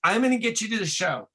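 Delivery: high, fast frantic promise; panicked. Retold or imitated. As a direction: blurt it out in a high panic to stop them. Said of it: "panics and he goes".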